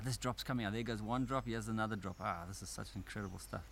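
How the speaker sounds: ordinary talk, nothing marked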